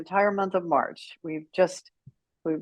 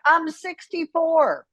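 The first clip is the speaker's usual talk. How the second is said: retort; 8 semitones higher, much slower than usual. Retold or imitated. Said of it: "I'm like".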